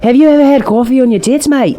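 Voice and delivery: very high, hammed-up Scottish-Australian; Scottish-Australian accent. Pestering co-host about coffee, hammed-up Scottish-Australian accent. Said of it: "I just put Scottish into Australian".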